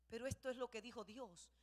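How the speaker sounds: usual speaking voice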